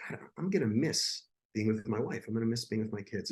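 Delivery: soft, tearful murmur, lightly voiced; a hint of crying while saying goodbye; retold crying. Dying husband texting his wife goodbye, softly tearful. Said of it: "I'm just sitting here crying".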